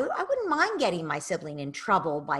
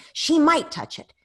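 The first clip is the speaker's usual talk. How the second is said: put-on louder, act out the grabby kid's fear; voicing a child; 7 dB louder than usual. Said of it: "child's inner voice".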